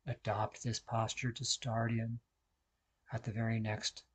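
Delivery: very soft, calm meditation voice; guided meditation. Real, not acted. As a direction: guide them into stillness, hushed, with long pauses. Leads a seated meditation.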